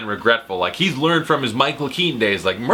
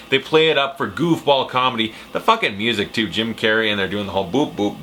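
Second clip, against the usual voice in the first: ordinary talk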